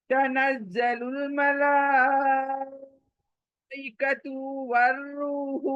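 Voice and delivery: slow, melodic tajweed recitation; Quranic recitation. Shown as a demonstration. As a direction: chant it the way it is recited.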